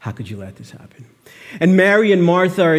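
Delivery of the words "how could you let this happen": near-whispered, lower, devastated; a broken hush asking God How. Shown as a demonstration. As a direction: let the question fall to almost nothing. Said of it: "Didn't this happen on your watch?".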